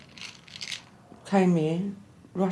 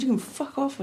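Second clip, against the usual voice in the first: higher mock snap at him; retold telling off; 7 semitones higher than usual. Woman retelling kicking out her cheating boyfriend, angry. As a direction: spit it out like you're throwing him out. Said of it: "I said".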